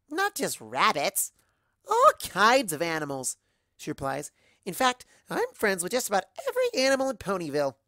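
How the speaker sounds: bubbly Pinky. Acted. Pinky answering the narrator, high and bouncy. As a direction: gush about her animal friends.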